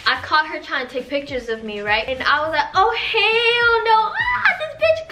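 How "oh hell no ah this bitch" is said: high, loud mock shriek, drawn out; shrill-pitched comic outrage, words stretched; outraged outburst. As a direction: re-enact an indignant screech. Retelling her outraged reaction to photographer.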